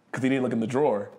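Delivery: normal talking voice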